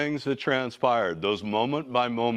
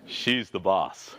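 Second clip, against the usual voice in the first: slow, amused aside with a laugh; 3 semitones lower, slower than usual. Real, not acted. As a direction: chuckle it out slowly. Laughing aside about the wife.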